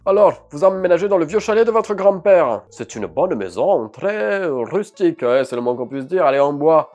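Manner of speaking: mayor's voice. Acted. Voix du maire.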